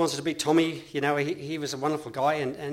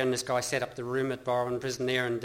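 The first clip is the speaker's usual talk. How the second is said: ordinary talk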